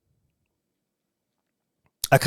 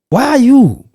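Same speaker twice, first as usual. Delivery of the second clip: much higher, loud shout; outraged shout; 9 semitones higher, 5 dB louder than usual. Real, not acted. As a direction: shout at the silent world. Outraged at world silence.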